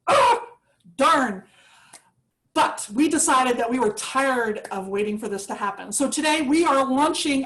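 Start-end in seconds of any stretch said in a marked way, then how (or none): 0.1-1.4 dismayed. Retold or imitated. Pandemic cancels planned launch.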